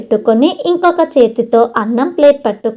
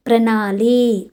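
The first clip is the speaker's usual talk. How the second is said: plain reading voice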